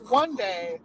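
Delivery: usual speaking voice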